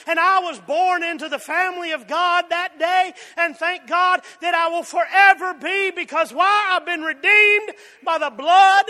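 loud preaching, building to a cry; preaching at full force. Real, not acted. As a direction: lift the congregation with a shout of praise. Preaching climax, rising to shouts.

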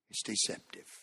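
whispered warning. Shown as a demonstration.

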